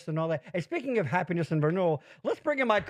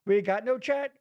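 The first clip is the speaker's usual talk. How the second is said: ordinary talk, nothing marked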